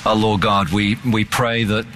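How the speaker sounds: slow, hushed closing prayer; earnest prayer. Acted. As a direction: pray it over the congregation.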